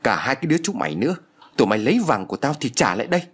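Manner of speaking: ordinary delivery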